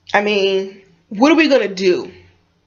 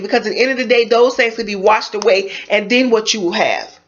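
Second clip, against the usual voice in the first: usual speaking voice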